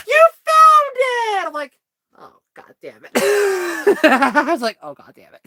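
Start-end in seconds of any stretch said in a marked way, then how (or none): 0.1-1.5 high, loud, slow exaggerated cheer; hammed-up congratulations; mock cheer. Boss congratulates Andrew on finding the toy, hammed-up and loud. 2.2-3.1 low, much softer groan of defeat; muttered dismay. Retold or imitated. Andrew curses quietly after being praised, low and soft. 4.8-5.4 low, much softer groan of defeat; muttered dismay. Retold or imitated. Andrew curses quietly after being praised, low and soft.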